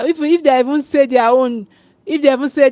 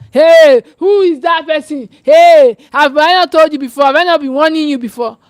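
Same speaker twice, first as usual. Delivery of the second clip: scolding parent; 3 semitones higher, 6 dB louder than usual. Acted. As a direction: yell it like telling off a child. Voices a parent scolding a child.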